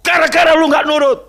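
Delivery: loud, high shouted "serves you right"; mock gloating scolding. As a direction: act out a furious parent rubbing it in. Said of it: "Apa saya syukurin?".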